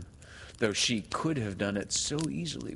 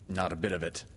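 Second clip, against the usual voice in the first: ordinary delivery, nothing marked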